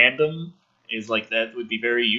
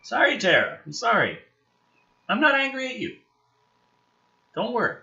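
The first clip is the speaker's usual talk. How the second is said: softer, gentle apology after the outburst; calm the scared pet down; reassuring pet; 9 semitones higher, 4 dB quieter than usual. Real, not acted. Commentator gently apologising to his pet after yelling.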